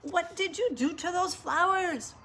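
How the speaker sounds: high-pitched aghast question; dismayed cry. A narrator's reading. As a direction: cry it out at the maid. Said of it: "she cried".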